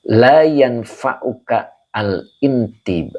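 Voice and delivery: drawn-out careful reading of "la yanfa'uka"; slow Arabic reading. Shown as a demonstration. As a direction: read the Arabic slowly, syllable by syllable. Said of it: "ada yang kurang".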